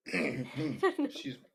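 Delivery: clearing a dusty throat. Acted. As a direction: cough through rubble dust. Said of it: "the dust of rubble got in my throat".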